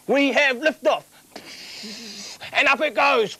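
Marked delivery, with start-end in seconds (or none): none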